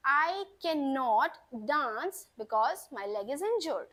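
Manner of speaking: very slow, clear dictation; slow model sentence. Shown as a demonstration. Teacher dictating the final example slowly to students. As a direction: read it out word by word. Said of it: "तो sentence कैसे बनेगा?".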